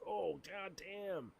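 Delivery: high, drawn-out mock dismay; mock shocked reaction. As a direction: stretch it out high, as if appalled. Reacting to harsh divorce energy.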